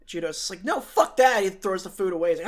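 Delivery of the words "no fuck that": defiant shout. Retold or imitated. Judo refusing defiantly.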